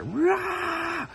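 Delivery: high shouted cry, slowly dragged; yelled cry of shock; screaming back. Retold or imitated. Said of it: "I screamed back at her".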